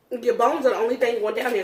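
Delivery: usual speaking voice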